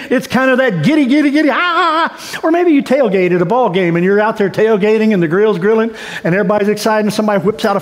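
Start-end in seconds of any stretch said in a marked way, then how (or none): 0.1-2.1 high, squealing giddy voice. Shown as a demonstration. Preacher showing the congregation giddy excitement. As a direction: bubble over with giddiness. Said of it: "you're kinda giddy".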